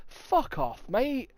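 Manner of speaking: mock-indignant local defending himself; indignant retort. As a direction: protest to imagined accusers. Retorts to commenters accusing him.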